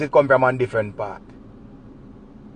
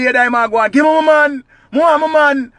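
high, loud wailing woman; desperate high bawl; Daima's wail; 11 semitones higher, 4 dB louder than usual. Acted. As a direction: cry out high and loud for her man. Daima bawling again.

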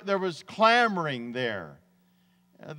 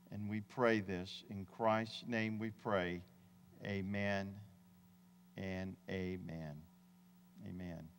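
hushed prayer; 6 semitones lower, 6 dB quieter than usual. Real, not acted. Pastor praying softly for help during a medical emergency, hushed. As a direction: pray quietly over the congregation.

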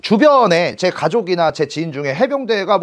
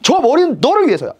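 friend's excited pitch; 8 semitones higher than usual. Retold or imitated. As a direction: hype him up like a friend selling a dream. Friend persuading him to join marines.

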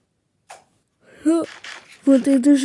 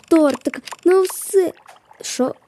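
ordinary talk

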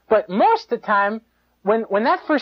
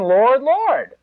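mock pious; 5 semitones higher, 3 dB louder than usual. Mocking pious gospel talk.

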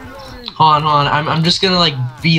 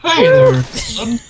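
squeaky high greeting; 11 semitones higher, 3 dB louder, much slower than usual. Acted. Greeting a zombie in game.

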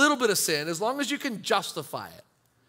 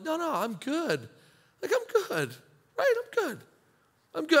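higher, breezy "I'm good" brushing it off; hammed-up denial, pitched up; mock defensive insistence; 8 semitones higher than usual. A believer drifting from God brushes off concern, mock defensive. Person insisting they're fine.

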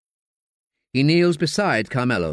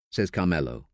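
ordinary delivery, nothing marked

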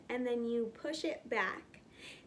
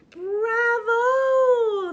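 much higher and louder cheer; delighted bravo; 12 semitones higher, 13 dB louder than usual. Acted. Lion cheering the child's pounce, delighted. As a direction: cheer it like a proud teacher.

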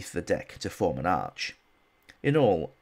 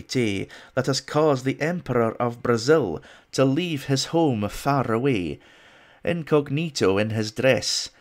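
loud, hammed-up Scottish accent reading a bad poem; over-the-top Scottish recital, louder and higher; 4 semitones higher, 4 dB louder than usual. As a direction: declaim it in a mock Scottish brogue. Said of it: "in my best Scottish accent".

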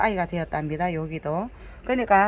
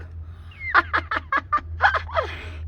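a high, real laugh at her own joke; high, amused laugh after calling the plant a foundling; burst of laughter. Real, not acted.

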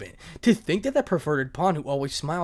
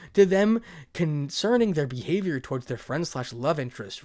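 ordinary delivery

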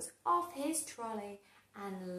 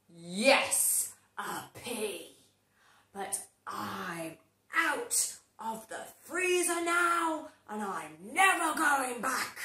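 villainous pea; 4 dB louder than usual. Acted. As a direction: gloat like a cartoon villain. Evil pea speaks.

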